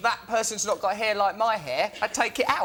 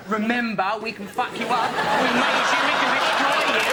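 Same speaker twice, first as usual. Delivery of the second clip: acted threat; 4 dB louder than usual. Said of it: "You're saying".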